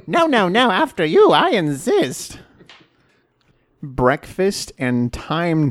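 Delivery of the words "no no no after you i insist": high-pitched, exaggerated courtesy; hammed-up high, mannered insistence; mock politeness. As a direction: overdo the polite deference as a joke. Mocking their over-polite back-and-forth.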